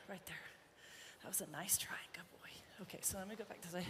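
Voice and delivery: whispered praise to the colt. Real, not acted. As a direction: hush it right down, breathy and close.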